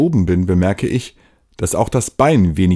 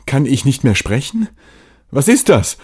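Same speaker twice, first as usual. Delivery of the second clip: alarmed; 5 semitones higher than usual. Acted. Panic as speech fails.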